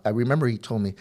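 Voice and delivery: normal talking voice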